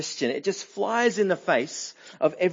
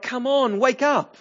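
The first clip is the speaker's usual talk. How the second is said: urgent wake-up call; 7 semitones higher, 4 dB louder than usual. Pretend. Rebuking voice urging believers awake.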